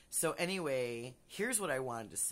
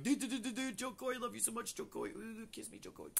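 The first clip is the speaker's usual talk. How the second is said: starstruck fan; 8 semitones higher than usual. Retold or imitated. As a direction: gush like a smitten fan. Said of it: "I was like".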